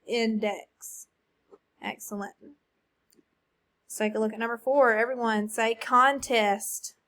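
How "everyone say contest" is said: normal talking voice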